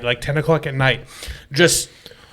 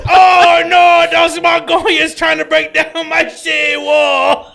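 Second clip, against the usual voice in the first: high, drawn-out wail in a mock Mongolian voice; 10 semitones higher, 3 dB louder, slower than usual. Friend imagines a Mongolian lamenting his city wall, hammed-up wail. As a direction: shout it slowly, hammed-up and high. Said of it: "fat Mongolian that we had as a friend".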